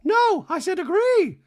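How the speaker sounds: very high, loud squeaky voice; hammed-up high Dorit voice; mock Dorit. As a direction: insist like a prim diva. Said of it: "Dorit goes".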